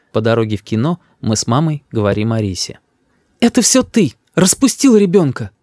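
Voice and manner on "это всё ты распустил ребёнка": high, reproachful mother; high-pitched nagging reproach; accusing mother. A narrator's reading. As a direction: blame your husband. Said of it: "говорит мама".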